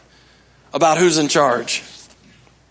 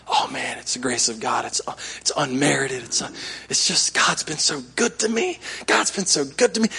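soft gushing; 4 semitones lower, 7 dB quieter than usual. Pretend. New believer gushing about grace.

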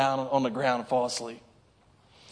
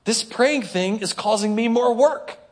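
mock complaint; 5 semitones higher than usual. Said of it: "You're like".

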